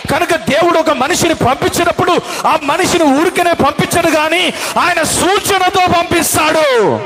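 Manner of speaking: high, ringing sermon climax shouted to the hall; full-force preaching. Acted. Preacher proclaiming signs to the congregation, full-force preaching. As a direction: preach it out with fire.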